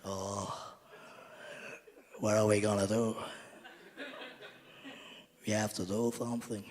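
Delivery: lightly voiced turtle, long pauses; turtle-like conductor. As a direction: imitate a helpless, plodding turtle. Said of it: "the turtle starts speaking again".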